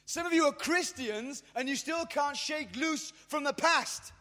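put-on high, loud and slow plea to the young; fervent preacher's appeal; impassioned preaching. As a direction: press the point home slowly and loudly.

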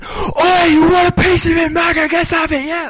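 loud, high shouting street thug; Trevethin thug. Shown as a demonstration. Preacher voicing David's mockers as a shouting hoodie thug. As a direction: square up and taunt like a hoodie. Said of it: "in kind of Trevethin language".

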